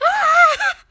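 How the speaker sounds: high, loud fan squeal; retold excited scream. Fan reacting to the staff member's video request, excited scream. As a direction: let out a piercing, overjoyed squeal. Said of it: "I'm, like, screaming".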